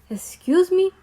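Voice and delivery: a man's rude repeat, mildly voiced; mock rude excuse me. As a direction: toss it back with attitude. Man repeating excuse me rudely.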